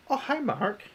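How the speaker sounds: quoting the cult film's famous line to viewers, imitating the actor high-pitched; The Room quote. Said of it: "That is a quality crap film".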